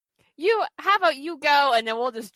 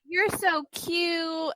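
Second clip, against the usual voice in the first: put-on slow, higher, doting drunk coo; 6 semitones higher, much slower than usual. Drunk Katie cooing at Chris, slow and higher. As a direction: drag it out like a tipsy flirt. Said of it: "she was like".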